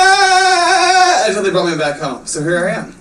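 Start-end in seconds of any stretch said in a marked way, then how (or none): 0.1-1.2 long, high sustained scream; hammed-up drawn-out "aaah"; mock scream. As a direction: hold a comic high wail. Imitates scream while driven around.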